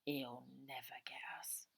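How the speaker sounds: soft whispered vow, barely audible; sworn under breath. A narrator's reading. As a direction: hiss a promise so no one hears. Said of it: "swear under her breath".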